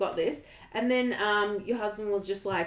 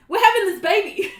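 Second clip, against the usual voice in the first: higher and louder, laughing through it; excited declaration; 11 semitones higher, 12 dB louder than usual. Retold or imitated. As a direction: burst out with it, half laughing. Retold decisive moment, laughing.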